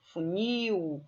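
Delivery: "Funil" with the NIL drawn out; stress on last syllable. Shown as a demonstration. Teacher showing the difference from réptil, "Funil" stressed on NIL. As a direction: lengthen the end of "Funil", fu-NIL. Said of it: "a sílaba tônica é no nil".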